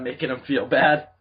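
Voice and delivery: ordinary delivery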